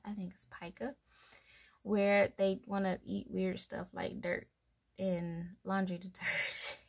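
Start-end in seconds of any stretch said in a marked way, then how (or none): 0.0-0.9 unsure whisper. Real, not acted. Unsure of the word, muttering.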